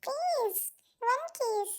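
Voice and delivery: quiet, slow begging for a kiss; soft romantic plea. Acted. Boyfriend softly begs his partner for one kiss. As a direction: coax him softly and slowly.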